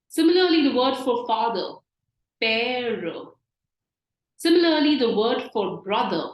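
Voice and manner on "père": "père" with a long open eh; stressed A sound. Shown as a demonstration. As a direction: stretch "père" out on the vowel. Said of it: "stressing on the A sound".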